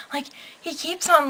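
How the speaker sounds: normal talking voice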